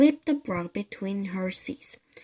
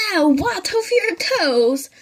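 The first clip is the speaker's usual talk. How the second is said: much higher, louder mock-scary old man; gleeful menace; 10 semitones higher, 7 dB louder than usual. Mr Twit gleefully frightening his wife. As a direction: relish scaring her.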